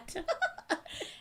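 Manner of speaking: high-pitched burst of laughter. Real, not acted. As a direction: squeal with laughter. Laughing at stomach noise.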